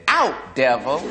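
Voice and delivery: half-laughing mock command. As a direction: say it with a chuckle. Narrator adds half-joking exorcism.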